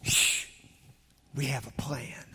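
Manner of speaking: breathy hush, barely voiced; whisper. Shown as a demonstration. Preacher whispering the book title to the church. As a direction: whisper it like a secret plan. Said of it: "our children's books is entitled".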